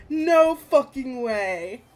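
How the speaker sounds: slow, loud disbelief; stunned excitement. Real, not acted. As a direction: stretch each word out in disbelief. Reader stunned by the reveal.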